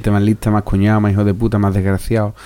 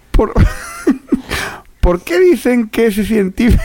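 put-on child voice; 12 semitones higher than usual. Host voicing a child asking his father a question, very high. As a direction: ask like a curious little boy. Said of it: "Yo tengo la voz ronca, tío, no me sale".

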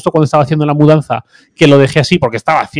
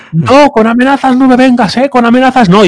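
much higher, louder mocking rant; mock shrill outrage; 11 semitones higher, 4 dB louder than usual. Mocking the angry neighbour to friends, high shrill outrage. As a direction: imitate the neighbour shrieking in indignation. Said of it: "empecé a decir".

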